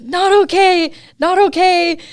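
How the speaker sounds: high, loud re-enacted cry; retold distressed cry. Rider re-enacts her only words after crashing, distressed cry. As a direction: cry it out as if just hurt, retold.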